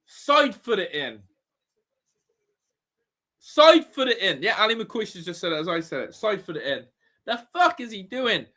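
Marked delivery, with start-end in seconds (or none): 3.4-4.4 loud, higher, exasperated yell; frustrated shout. Real, not acted. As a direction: shout it at the striker in disbelief. Repeating frustrated advice at replay.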